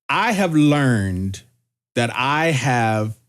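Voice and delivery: slow, measured, with pauses between phrases; deliberate, halting delivery; careful wording. Real, not acted. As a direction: choose each word carefully, drawing it out. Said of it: "I wanna be careful with my wording".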